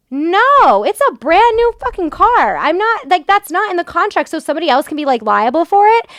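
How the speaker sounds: high, loud outraged protest. Real, not acted. To the driver offering a tow truck, loud outraged protest. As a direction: fire it back in outrage.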